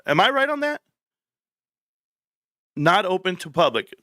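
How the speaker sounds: high, eager thanks to the chat; thrilled the chat backs him up; relieved excitement. Real, not acted. Viewers confirm he was right.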